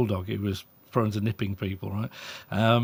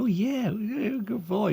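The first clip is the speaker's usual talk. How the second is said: much higher, grinning praise voice; goofy happy praise; 10 semitones higher, 3 dB quieter, slower than usual. Shown as a demonstration. Trainer shows owner smiling praise for dog. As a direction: beam like a village idiot while praising. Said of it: "smile like a village idiot".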